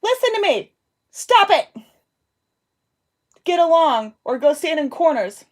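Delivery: loud, high, firm mom scold; stern scolding. Real, not acted. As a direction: shout them into line, no nonsense. Mom scolding fighting kids over intercom.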